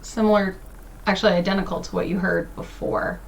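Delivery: normal talking voice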